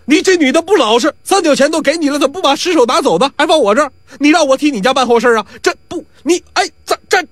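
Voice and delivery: high, fast indignant outburst; flustered anger. Acted. Fat Jin yells after the woman who left the corpse, high and flustered. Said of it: "这金二胖子急了".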